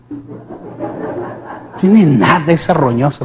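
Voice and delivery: slow, mocking laugh through the words; scornful chuckle, slowed right down. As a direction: laugh at the devil.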